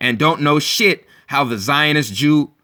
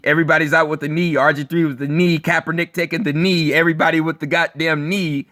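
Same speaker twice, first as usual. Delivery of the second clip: ordinary talk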